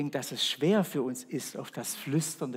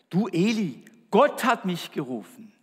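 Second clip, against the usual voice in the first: put-on higher, louder, eager young Samuel; eager boy's call; 5 semitones higher, 6 dB louder than usual. Samuel rushes to Eli, eager and raised. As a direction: call out like a keen boy woken by God.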